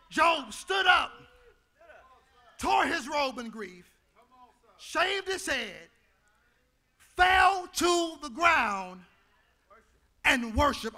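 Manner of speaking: slow, weighty preaching of the story. Real, not acted. Pastor telling Job's story, slow weighty preaching. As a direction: lay each act down slowly.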